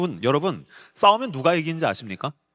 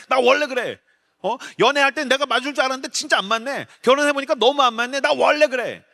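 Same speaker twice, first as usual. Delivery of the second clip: defiant sulk; 8 semitones higher than usual. Retold or imitated. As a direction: refuse to give an inch, defiant. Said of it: "내가 지기 싫을 때 뭐라고 얘기하는지".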